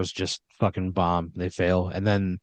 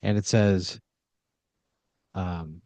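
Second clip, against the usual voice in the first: ordinary delivery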